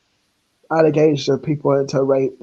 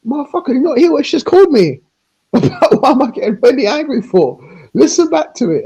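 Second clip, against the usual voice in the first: much higher and louder, fast, with laughter; retold angry reply; 11 semitones higher, 6 dB louder than usual. Her retold reply to Rowan, loud high rant. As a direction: fire it back in a high-pitched rant. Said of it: "I was like".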